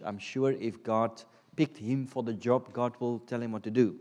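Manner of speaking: ordinary talk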